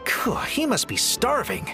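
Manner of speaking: high-pitched burst of concern; exclaiming. Acted. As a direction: exclaim it with a relieved puff. Concern over rescued person's hunger.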